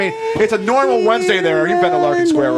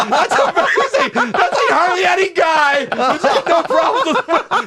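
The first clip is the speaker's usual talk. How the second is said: high, slow laughing amazement; amazed; 5 semitones higher, slower than usual. Real, not acted. Host marveling at Frank's clean record, amazed. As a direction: crow in laughing disbelief.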